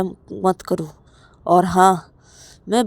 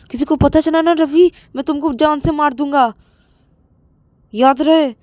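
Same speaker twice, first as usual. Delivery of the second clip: threatening; 7 semitones higher, 8 dB louder, faster than usual. Acted. Rahul threatens Ravi privately.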